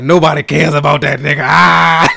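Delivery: loud, high imitation of a cackling wife; hammed-up hysterical jeer; hysterical laughing. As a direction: shout it through mocking laughter. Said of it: "starts laughing hysterically".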